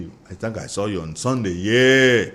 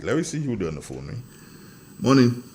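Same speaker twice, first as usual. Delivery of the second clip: ordinary delivery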